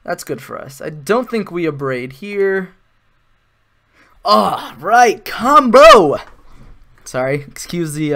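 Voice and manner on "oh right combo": loud, high shout building to a peak; ear-splitting shout. Real, not acted. Gamer springing a combo on viewers, shouting excitedly. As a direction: holler it up to a full-blast finish. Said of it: "excuse your ears".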